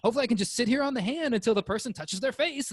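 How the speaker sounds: high, perky little virus voice; cute germ voice. Retold or imitated. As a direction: play a cheeky germ plotting its way in. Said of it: "it's not sitting on your hand like".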